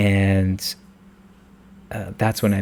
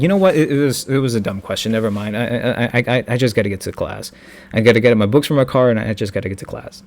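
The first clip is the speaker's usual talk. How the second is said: flustered rush; faster than usual. Retold or imitated. Flustered excuse to leave.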